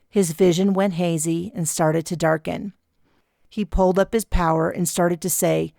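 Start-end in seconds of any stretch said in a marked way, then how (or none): none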